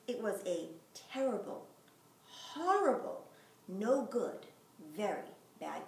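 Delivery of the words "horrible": horrible, much higher and louder; punched horrible. A narrator's reading. Reading the refrain, horrible punched. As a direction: hit horrible hard.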